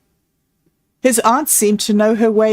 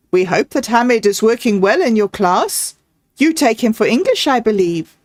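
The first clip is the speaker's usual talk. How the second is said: usual speaking voice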